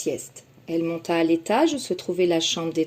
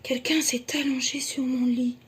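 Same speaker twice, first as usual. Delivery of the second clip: soft voice; 5 semitones higher than usual. Acted. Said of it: "d'une voix toujours douce".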